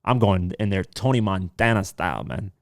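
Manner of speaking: ordinary talk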